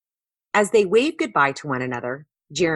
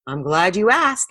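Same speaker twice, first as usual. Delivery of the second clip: plain reading voice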